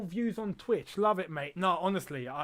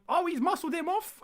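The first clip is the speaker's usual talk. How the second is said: much higher burst; excited; 11 semitones higher than usual. Real, not acted. Streamer calls a Morecambe challenge, excited. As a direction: jump up at the tackle.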